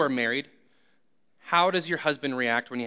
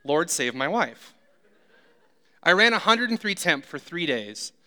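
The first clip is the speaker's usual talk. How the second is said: usual speaking voice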